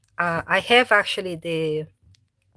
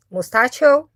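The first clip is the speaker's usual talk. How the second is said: high, louder call with a rising end; 7 semitones higher, 10 dB louder than usual. Real, not acted. Owner calling her cat to come, rising call. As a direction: call the cat from across the house.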